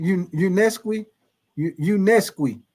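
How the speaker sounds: Unesqui slowly, restarting on the first syllable; tentative pronunciation. Shown as a demonstration. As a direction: carefully attempt Unesqui, drawing it out. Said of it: "Did I say that right".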